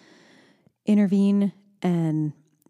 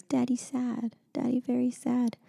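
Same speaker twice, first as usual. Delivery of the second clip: higher, lightly voiced little child; a hint of little-kid voice, pitched up; imitating toddler daughter; 6 semitones higher, 4 dB quieter, slower than usual. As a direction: softly voice a small child's observation. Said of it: "And she goes".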